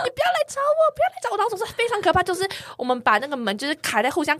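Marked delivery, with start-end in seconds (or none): 0.0-1.4 frantic pleading. Retold or imitated. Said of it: "因为我像疯子一样".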